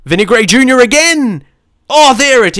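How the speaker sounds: high, exclamatory celebration; thrilled cry at a second goal; excited goal call. Real, not acted. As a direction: call out the scorer as it goes in. Reacting to another goal.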